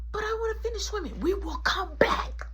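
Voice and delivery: put-on high, whiny child voice; high-pitched mock pleading; pleading kid. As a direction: imitate a kid begging to keep swimming. Kid pleads, storyteller voices argument.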